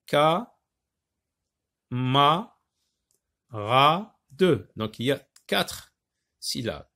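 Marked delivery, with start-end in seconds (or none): 0.0-4.7 Ka, ma, ra, de with long pauses; four detached beats, Ka - ma - ra - de; syllable by syllable. Shown as a demonstration. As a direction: sound out each syllable separately. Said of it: "Donc, je découpe ici".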